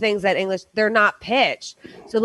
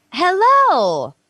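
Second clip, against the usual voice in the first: "Hello" said higher and louder, falling; high pitched hello; 7 semitones higher, 4 dB louder than usual. Shown as a demonstration. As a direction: sing "Hello" up high then let it fall. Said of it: "When you say something like".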